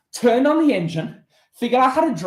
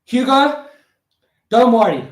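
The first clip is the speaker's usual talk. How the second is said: ordinary talk, nothing marked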